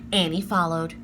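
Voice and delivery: usual speaking voice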